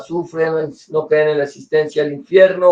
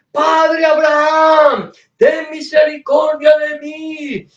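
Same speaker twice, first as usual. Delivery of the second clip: crying out for mercy; 8 semitones higher than usual. Acted. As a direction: shout it up to Abraham from the flames. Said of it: "y empezó a gritar".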